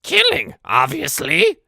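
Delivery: very high, loud goblin voice; piercing goblin exclamation. Acted. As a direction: shriek it like an eager little goblin. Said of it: "the goblin kinda looks at me".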